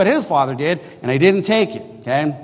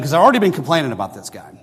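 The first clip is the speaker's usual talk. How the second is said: normal talking voice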